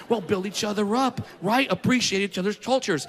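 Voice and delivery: ordinary talk, nothing marked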